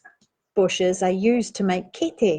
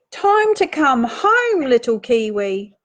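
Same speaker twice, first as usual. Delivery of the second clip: high, loud, drawn-out call home; storybook sing-out, stretched and high; 3 semitones higher, 4 dB louder than usual. Acted. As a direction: call out slowly to a lost little one. Reading aloud, calling little kiwi home.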